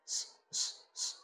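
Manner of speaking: স as a short voiceless hiss; isolated sibilant. Shown as a demonstration. Teacher isolates the first sound, soft hissed স. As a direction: hiss স alone, softly. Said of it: "বলুন আমার সাথে".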